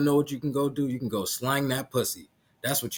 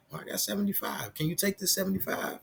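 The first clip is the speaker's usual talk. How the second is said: higher, softer mock plea; retold dad's plea; 5 semitones higher, 8 dB quieter than usual. Dad asking mom to accept less money, lightly pleading. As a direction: lightly act out a meek, higher-pitched offer. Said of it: "and he like".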